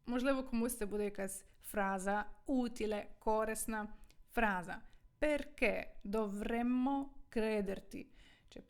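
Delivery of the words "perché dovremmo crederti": slow, spaced-out Italian question for learners; careful, slowed-down teacher's delivery; slow model reading. Shown as a demonstration. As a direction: stretch the question out word by word. Model sentence, first slow, then natural.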